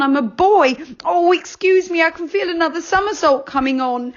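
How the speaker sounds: put-on high, loud young boy voice, anxious and apologetic; boy character, high and loud; Joe, a boy character. A narrator's reading. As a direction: play a flustered little boy who keeps apologising. Joe's voice in the story.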